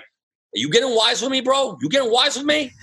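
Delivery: mock Italian-American tough guy, high and pushy; old school Italian. Club director threatening the new DJ, mock Italian tough guy. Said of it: "old school Italian".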